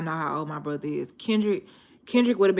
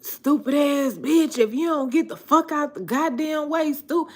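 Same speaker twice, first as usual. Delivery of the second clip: imitating brother's road rage; 10 semitones higher than usual. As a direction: play the brother cursing other drivers, pitched way up. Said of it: "he be like".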